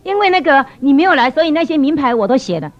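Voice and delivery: high, loud flustered apology; acted-out anxious apologizing; nervous apology. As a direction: blurt out excuses, imitating a flustered colleague. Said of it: "她就很紧张了".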